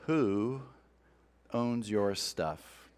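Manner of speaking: asked slowly and softly, letting it hang; slow quiet question. Shown as a demonstration. Preacher asking the congregation, slow and hushed. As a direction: lean in and drop the voice on the question. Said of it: "let me start by asking you".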